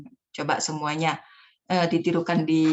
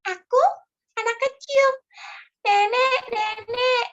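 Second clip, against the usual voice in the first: put-on high, louder little kid pleading; child voice; 4 dB louder, slower than usual. Shown as a demonstration. Storyteller demos a child asking grandma for help, high and loud. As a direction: call to grandma like a small child. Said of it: "kita ngomong dua orang".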